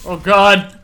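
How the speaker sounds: sudden loud oh god; startled outcry. Real, not acted. Gamer caught off guard by an enemy, loud alarm. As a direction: cry out as you get caught. Dying in game.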